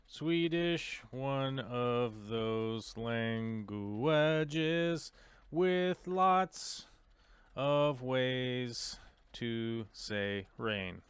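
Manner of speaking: very slow, stretched-out words. Real, not acted. Streamer typing a question about Swedish, slow and drawn out. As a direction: draw each word out as you type it. Speaking a search while typing.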